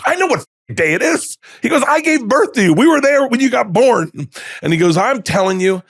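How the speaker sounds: high, loud mock outburst; imitating his dad yelling back at him; shouting dad. Dad yells at son over the phone, loudly.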